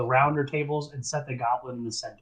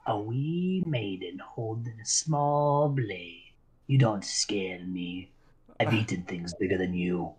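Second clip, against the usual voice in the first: slow, low, menacing goblin taunt; fearless goblin; 5 semitones lower, slower than usual. Acted. Captured goblin taunting the swordswoman, slow and low. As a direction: sneer at her without a shred of fear. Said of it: "looks you dead in the eyes with no fear and goes".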